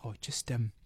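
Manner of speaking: low, soft, hesitant mumble; awkward admission. Retold or imitated. Baker owning up to paper in the pie, awkward and hushed. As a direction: break the bad news quietly and sheepishly.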